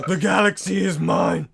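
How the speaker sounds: loud, snarling evil emperor; cold rasping evil emperor. Retold or imitated. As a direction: bellow it like a mad Sith lord. Quoting Palpatine's line.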